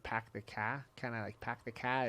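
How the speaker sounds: put-on half-hearted New England-style accent, R dropped; tentative go at a New England accent; attempted regional accent. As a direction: softly try out an East Coast accent. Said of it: "he had, like, that cool accent".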